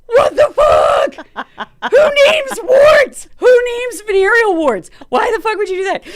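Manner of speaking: very high, loud incredulous shrieking; retold outraged yelling. Patient hearing doctor name the wart, outraged shriek. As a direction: shriek each question in mock outrage, drawing it out. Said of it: "And I was like".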